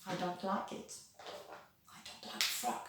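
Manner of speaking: very soft, almost whispered; hushed aside. Pretend. Teacher confiding she dislikes frogs, hushed aside. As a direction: drop right down to a confiding whisper.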